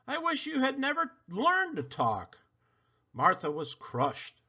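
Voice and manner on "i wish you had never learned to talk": put-on high, softer child voice; a girl lashing out at her dog; hurt child. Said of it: "said Helen".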